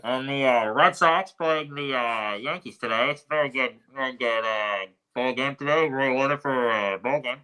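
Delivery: put-on old-time radio sportscaster, slowed at the start; vintage announcer voice. Shown as a demonstration. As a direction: announce the ball game like a vintage radio host. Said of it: "How's your vintage announcer voice?".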